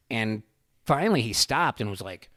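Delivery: ordinary talk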